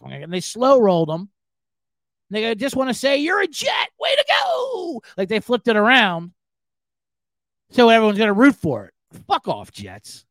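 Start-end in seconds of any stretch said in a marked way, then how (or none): 2.5-5.0 high shouted congratulations rising to a yell; spring the good news like a game show host; mock coach cheer. Coach surprising the rookie who thinks he's cut, high excited shout.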